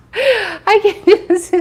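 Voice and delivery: high, slow words through laughter; real laughing, pitched right up; cracking up. Real, not acted. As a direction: laugh helplessly while getting the words out. Cracking up after own joke.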